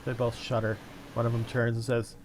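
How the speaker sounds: ordinary talk, nothing marked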